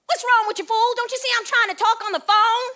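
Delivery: loud, high indignant yell; angry outburst. Retold or imitated. Woman on payphone yelling at the person banging, irritated. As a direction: tell off whoever is interrupting, at full volume.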